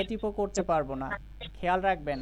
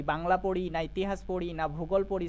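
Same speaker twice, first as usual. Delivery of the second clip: ordinary talk, nothing marked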